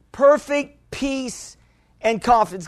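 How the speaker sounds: "Perfect peace" drawn out very slow, each word leaned on; slow emphatic repeat. Real, not acted. Pastor repeating scripture to congregation, slow and emphatic. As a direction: let "Perfect peace" sink in slowly for emphasis.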